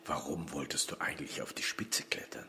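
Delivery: soft, hushed question; whisper. Acted. Son whispers his question to his father in the cell. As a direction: lean in and ask it in a whisper.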